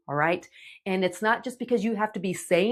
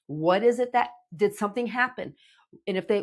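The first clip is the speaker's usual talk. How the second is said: ordinary talk, nothing marked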